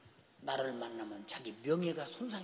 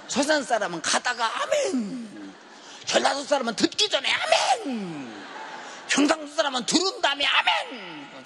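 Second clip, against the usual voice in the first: loud, high sermon joke through laughter; laughing loud preaching; 8 semitones higher, 12 dB louder than usual. Acted. Pastor teases the congregation about regional amens, laughing loudly. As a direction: tease the crowd while preaching hard.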